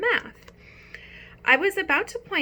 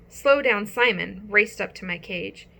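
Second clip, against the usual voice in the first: ordinary delivery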